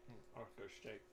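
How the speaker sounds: ordinary talk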